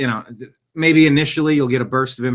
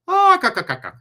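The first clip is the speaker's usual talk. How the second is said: silly high Irish-accent squawk, mock Irish; a quick hammed-up Irish noise; 6 dB louder than usual. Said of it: "I don't know why you went Irish".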